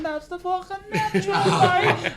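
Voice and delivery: put-on high, laughing Cork accent; laughing Cork catchphrase. Friends repeating a Cork catchphrase, laughing, Cork accent. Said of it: "we're going".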